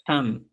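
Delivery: "ham" with a breathy j onset, low and soft; Chanca j sound. Shown as a demonstration. Teacher models Chanca "ham" softly for the class. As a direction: say "ham" like a Spanish jota. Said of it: "y en quechua chanca digo".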